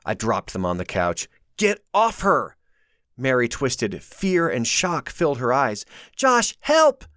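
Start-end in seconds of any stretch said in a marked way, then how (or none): 1.6-2.6 higher urgent shout. A narrator's reading. Josh shouting to free Mary. 6.2-7.1 high, loud terrified call; cry for help. A narrator's reading. Mary pleading to Josh, terrified.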